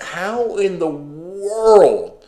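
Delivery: very slow, drawn-out disbelief; stunned, slow incredulous question. Retold or imitated. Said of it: "I'm just like".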